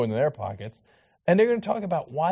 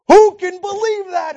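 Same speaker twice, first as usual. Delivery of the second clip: loud, high cry of disbelief; mocking the preachers, loud and high; incredulous outburst; 14 semitones higher, 10 dB louder than usual. Real, not acted. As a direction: exclaim it in disbelief.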